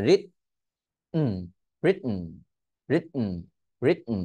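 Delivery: "রিটন" as rit, catch, hummed n; "রিট" stopped, then nasal "উম"; glottal stop T. Shown as a demonstration. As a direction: swallow the T in "রিটন", no vowel before n. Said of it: "মানে আমি টি উচ্চারণ করতেছি না".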